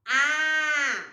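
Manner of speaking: A held long and loud; letter sound demo. Shown as a demonstration. Teacher showing children the sound of A, sounded out. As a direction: sustain a clear, steady A. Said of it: "E o A é assim".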